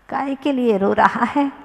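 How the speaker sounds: usual speaking voice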